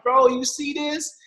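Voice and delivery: high and slow, savouring it; proud wonder. Retold or imitated. To cousin Jason, showing the check, slow proud wonder. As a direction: drag it out, amazed at himself.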